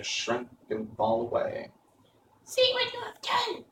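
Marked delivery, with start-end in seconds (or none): 2.4-3.6 contained witch's scream, pitched far up. A narrator's reading. Witch blames Dorothy as she melts, high-pitched mock scream. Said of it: "she screamed".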